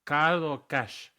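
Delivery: high, loud, drawn-out cashier voice; mock cashier. Cashier answering tourists with learned English words, slow high imitation. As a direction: imitate a cashier slowly offering two choices. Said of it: "the cashier goes".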